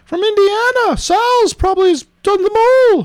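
high British character voice; British accent. Acted. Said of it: "I'm doing it in a British accent".

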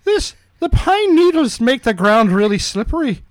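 high put-on boy voice; Brendan. Boy who nearly fell on pine needles, high kid voice. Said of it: "I'll be Brendan".

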